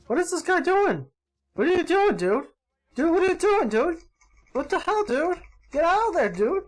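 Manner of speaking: high-pitched, incredulous scolding; frantic gamer exasperation; exasperated. Real, not acted. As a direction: plead with a teammate in high disbelief. Exasperated at a teammate's play.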